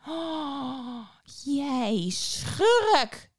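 high, strained witch cry. A narrator's reading. Witch exclaims in shock at the boy. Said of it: "riep ze uit".

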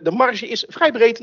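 ordinary delivery

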